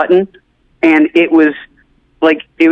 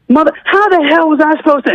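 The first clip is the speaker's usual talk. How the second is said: high-pitched mock exasperation; exasperated host; 10 semitones higher than usual. Host told he asked the wrong guy, exasperated. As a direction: act out losing it, pitch jumping up. Host acted losing it at mistake.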